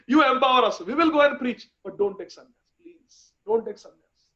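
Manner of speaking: high-pitched, emotional begging; tearful pleading. Acted. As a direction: beg through tears, loud at first, then falling back. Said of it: "They are crying. They are saying".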